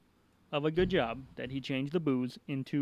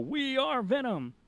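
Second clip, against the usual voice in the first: retold group yell; 10 semitones higher, much slower than usual. Host imitating the masked panel crowd on cue, mock yell. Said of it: "and then yell".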